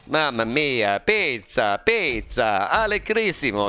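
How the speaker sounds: jolly Italian pizza chef, lightly done; a hint of a cartoon Italian accent. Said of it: "that sounds more like Luigi".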